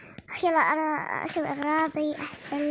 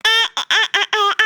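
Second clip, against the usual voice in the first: mock laughing; 5 semitones higher, 6 dB louder, much faster than usual. Doll laughing then apologising.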